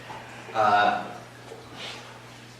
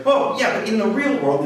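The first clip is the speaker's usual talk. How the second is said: earnest correction; 8 semitones higher, 5 dB louder than usual. Retold or imitated. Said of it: "I kept saying things like".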